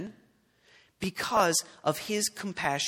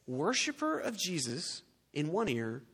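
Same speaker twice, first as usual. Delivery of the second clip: ordinary talk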